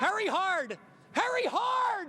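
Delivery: high loud sweeping shout; curling skip yelling. Real, not acted. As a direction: yell it down the ice. Reporter echoes sweep call shouting.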